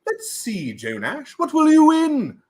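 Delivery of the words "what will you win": excited. Pretend. Hype building up.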